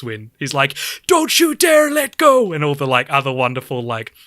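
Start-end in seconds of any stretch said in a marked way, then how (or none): 1.1-2.5 high, slow, heroic plea; mock-epic desperate cry; hammed-up heroic shout; dramatic movie cry. Retelling a dramatic movie line.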